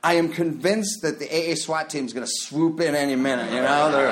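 ordinary talk, nothing marked

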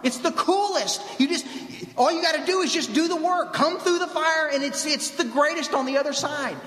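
high, urgent yell calling others over; excited yelling. Acted. Convert yelling to guys across the fire, excited high call. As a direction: call out high, stretching the key words. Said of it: "He yells at those guys".

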